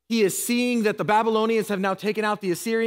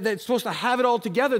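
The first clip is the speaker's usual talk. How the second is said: normal talking voice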